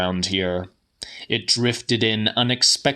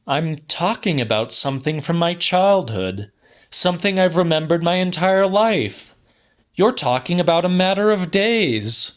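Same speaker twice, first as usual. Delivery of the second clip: higher, rising indignant protest, quickening at the end; indignant companion; 8 semitones higher than usual. Acted. Quisser objecting to the narrator, higher and indignant. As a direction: push back, getting heated.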